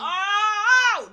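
sudden high shout of shock; a real yell at the TV; yelling out loud. Retold or imitated. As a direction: yelp it out at the screen. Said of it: "That was literally me yelling out loud".

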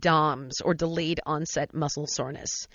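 loud, emphatic radio commercial delivery; ad read. Real, not acted. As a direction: sell it to listeners with punch. Host reads a product ad.

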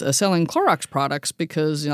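normal talking voice

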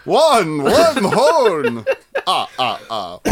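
high, loud Count-like counting; hint of the Count from Sesame Street. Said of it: "You sound like the Count a little bit".

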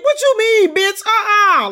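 very high, loud outraged screech; audible scream. Retold or imitated. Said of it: "let out an audible scream".

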